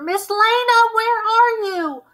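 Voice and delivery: very high, loud desperate call; frantic calling. A narrator's reading. Kid shouting desperately for Miss Lena through the bloody house, high loud call.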